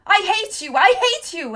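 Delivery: high, loud furious yell; yelling hate. A narrator's reading. Hester yells at Tom, furious. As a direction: hurl it at him twice. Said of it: "Hester was yelling".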